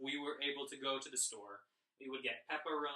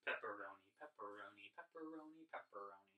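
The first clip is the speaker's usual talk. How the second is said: very soft, low rhythmic repetition; thinking it through; 6 semitones lower, 13 dB quieter, faster than usual. Shown as a demonstration. Teacher testing how the word fits one beat, quietly thinking aloud. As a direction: murmur it to yourself in an even beat. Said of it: "Let's think about it".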